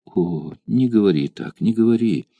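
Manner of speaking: usual speaking voice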